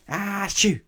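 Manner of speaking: a very high, loud sneeze. Acted. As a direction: sneeze hard. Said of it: "and sneezed again".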